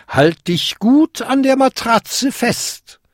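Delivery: put-on high, slow old-lady command; high, drawn-out, emphatic instruction; fairy godmother instructing. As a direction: tell the goblin slowly, pitched high.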